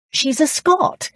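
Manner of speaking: high, slow, surprised exclamation; delighted surprise at a new discovery. A narrator's reading. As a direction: pitch jumps up in slow astonishment. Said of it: "Gillian said with surprise".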